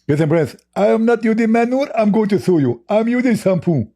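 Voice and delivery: squeaky huffy rival protesting; mocking Zakir Naik. Debater voicing his rival's offended reply, high and indignant. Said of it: "what kind of manure you are using for your beard".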